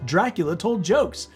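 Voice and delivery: ordinary delivery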